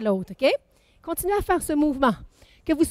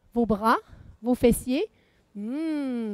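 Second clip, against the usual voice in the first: ordinary talk, nothing marked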